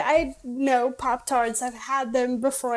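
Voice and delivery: normal talking voice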